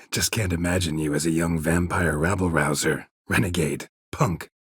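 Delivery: ordinary delivery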